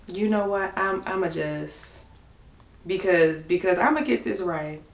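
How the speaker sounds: soft, careful concentration; hushed as if reading carefully; quiet and focused. Real, not acted.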